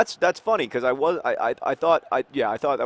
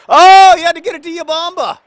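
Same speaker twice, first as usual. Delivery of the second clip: very high, loud exasperated wail; frustrated cry; 15 dB louder than usual. Real, not acted. Host groaning at his team's missed pass, loud frustrated wail. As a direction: groan at a blown chance, loud.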